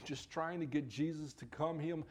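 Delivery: usual speaking voice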